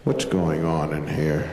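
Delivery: a low, slow, stern father's voice; dad demanding answers, low and slow. Acted. Father checks on kids, low and stern. Father bursts into kids' room.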